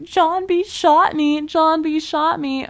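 high, drawn-out fake victim wail; hammed-up accusing whine; mock crying wolf. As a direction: cry wolf in a slow, high voice. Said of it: "little boy who cried wolf".

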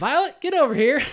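high, loud mock summons; acted urgent call. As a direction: holler for a friend to come. Acts character calling Violet over.

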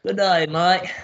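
put-on a botched try at an Aussie accent; bad Australian accent. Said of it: "I can't do an Australian accent".

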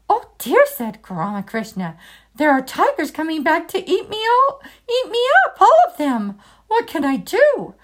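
frantic fear of the tigers; frightened cry. Acted. Ramakrishna hears tigers coming back, high panicked cry.

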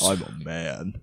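low put-on manly voice; deep manly voice. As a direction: push it down into the chest. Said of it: "with your chest voice".

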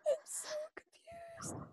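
breathless confused whisper. Real, not acted. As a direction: whisper it in disbelief. Whispered disbelief after laughing.

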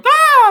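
high, loud hammed-up falling scream; mock scream. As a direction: yelp like a kid tumbling downhill. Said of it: "And they'd go".

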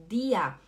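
dir pronounced "dia". Shown as a demonstration. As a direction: end "dia" on an open a, no r. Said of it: "Imagina que tem um A".

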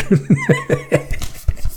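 hohes, echtes Auflachen; lautes Lachen. Real, not acted. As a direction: lach laut und hoch heraus. Vorleser lacht über Satz. (echtes Lachen über den eigenen Text)

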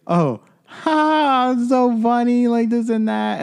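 very high mock squeal of amusement; put-on high party-girl voice; drunk girl. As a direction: imitate a giddy drunk girl in falsetto. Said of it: "the drunk girl was just like".

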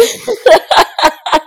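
high loud burst of laughter. Real, not acted. Laughs at her own story.

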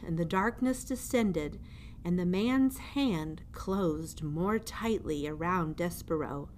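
plain reading voice

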